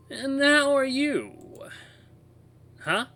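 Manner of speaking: very high, loud, drawn-out woman's voice. Acted. Distorted toy radio greets the narrator, high woman's voice. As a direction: voice a woman through an old radio, very slowly. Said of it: "sounds like a woman's voice".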